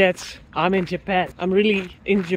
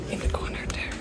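hushed, awed whisper; 5 dB quieter, faster than usual. Real, not acted. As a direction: whisper so as not to disturb. Whispering in the temple.

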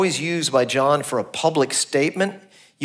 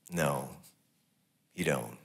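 much lower and softer "No, you don't"; hushed flat denial; 12 semitones lower, 13 dB quieter than usual. Shown as a demonstration. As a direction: drop to a quiet, final no. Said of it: "Jesus looks at them and says".

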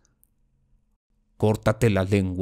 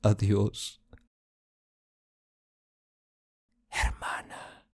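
soft goodbye dying to a breath; subdued, halting parting words; hushed farewell; 7 dB quieter, slower than usual. Acted. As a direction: let the last word almost vanish. Final farewell to his sister.

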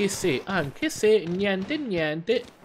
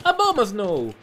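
excited call; 8 semitones higher, 6 dB louder than usual. Real, not acted. Calling out wild Pokémon's name.

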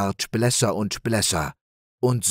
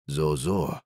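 lower, softened stag hum; musing stag; 6 semitones lower, 3 dB quieter than usual. A narrator's reading. The stag answers Ulla, lower and a little friendlier. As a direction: soften toward them. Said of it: "sagte der Hirsch".

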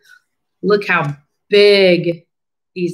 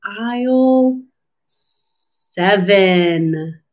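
ordinary delivery

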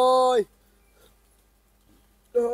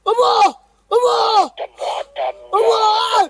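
panicked, raised pleas; frightened cries; 10 semitones higher, slower than usual. Real, not acted. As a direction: cry out to God in terror. Crying out after seeing a figure.

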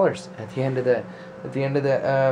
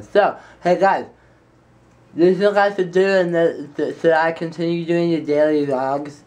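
tongue out; 5 semitones higher, 6 dB louder than usual. Pretend. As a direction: stick your tongue out while talking. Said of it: "with your tongue sticking out the whole time".

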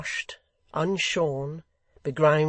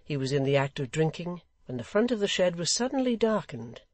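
usual speaking voice

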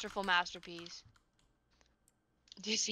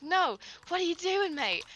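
higher, retold British accent; British accent slipping out; 8 semitones higher, 4 dB louder than usual. Streamer retelling how the accent slips out, high British accent. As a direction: let a mock British accent slip. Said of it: "it just slip out again".

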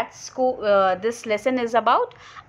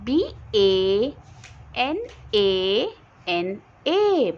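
slow, letter-by-letter spelling; spelled out letter by letter; 4 semitones lower, much slower than usual. Shown as a demonstration. Teacher spelling banana slowly for class. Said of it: "spelling the word for students".

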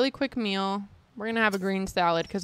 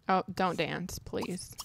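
ordinary delivery

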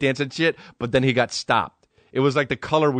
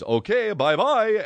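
high, singsong cartoon farewell; playful put-on exit line; Dracula; 10 semitones higher, slower than usual. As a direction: toss off a silly vampire goodbye, pitched way up. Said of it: "you know when Dracula says".

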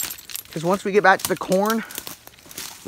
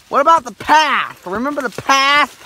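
loud complaint; 10 semitones higher, 8 dB louder than usual. Real, not acted. Wading into cold water.

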